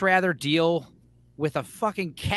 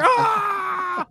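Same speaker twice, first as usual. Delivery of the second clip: high shouted "aah"; mock scream; 13 semitones higher than usual. Storyteller acting out blood spraying everywhere, mock scream.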